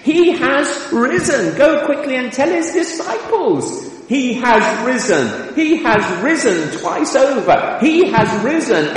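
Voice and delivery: high and loud, triumphant declaration; ringing triumphant announcement of the risen Christ; exhilarated proclamation. Retold or imitated. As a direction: proclaim it like the best news ever. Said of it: "Exclamation mark".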